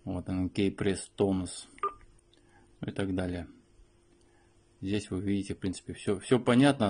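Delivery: normal talking voice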